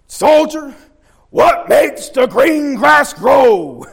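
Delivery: barked drill sergeant call, slow and loud; gruff drill sergeant voice. Retold or imitated. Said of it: "that old gruff, gravelly drill sergeant voice".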